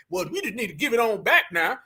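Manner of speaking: ordinary talk, nothing marked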